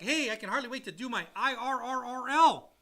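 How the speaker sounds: hammed-up excited client; eager borrowers. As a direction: play the impatient client who can't wait. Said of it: "people are like".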